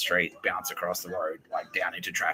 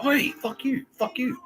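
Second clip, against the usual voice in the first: much higher, acted protest; mock indignant call-out; slower than usual. Comic to jerks stepping on cameras, mock indignant. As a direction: call them out in a high, retold outrage.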